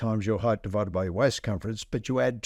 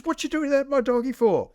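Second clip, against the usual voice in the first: much higher and louder, an indignant cry of shock; outraged protest; 13 semitones higher, 4 dB louder than usual. Acted. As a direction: yelp it like the owner who just saw his donkey clobbered. Owner sees his donkey whacked.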